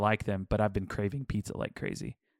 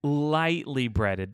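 "Lightly" drawn out very slowly; stress on LIGHTLY; 3 semitones higher, much slower than usual. Real, not acted. Defending lightly breaded shrimp to a doubting friend, stress on LIGHTLY. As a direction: stretch "Lightly" long, then land "breaded".

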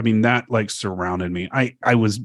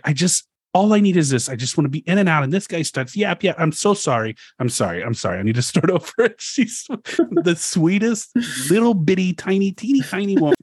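higher, sweet apologetic little-lady voice; 7 semitones higher than usual. Retold or imitated. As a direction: play her as tiny, flustered and sorry. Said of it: "that's more [name] talk".

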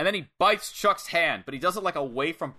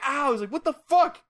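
freaking out; 12 semitones higher than usual. Retold or imitated. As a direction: imitate someone freaking out after a bite. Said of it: "freaking out on the ropes".

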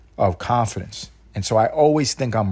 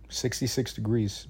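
usual speaking voice

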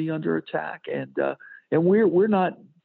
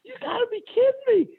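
disbelief; faster than usual. Retold or imitated. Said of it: "And I'm like".

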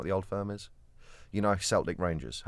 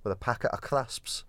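higher-pitched attempted Scottish; Scottish accent; 6 semitones higher, slower than usual. Shown as a demonstration. Showing co-host a Scottish accent, higher put-on Scottish. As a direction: have a go at broad Scottish. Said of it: "I can't do a Scottish accent".

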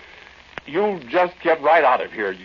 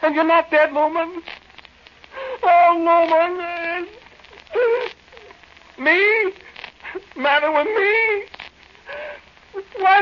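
weep out the happiness; crying with joy; slower than usual. Acted. Overjoyed friend is alive.

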